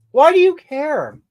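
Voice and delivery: loud, high-pitched challenge; indignant retort. Real, not acted. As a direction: fire it back at a nosy viewer. Snaps back at viewer's question.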